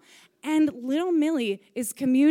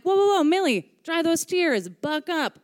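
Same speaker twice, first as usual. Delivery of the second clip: mock pep talk; 7 semitones higher, 3 dB louder than usual. Retelling her pep talk to crying kid.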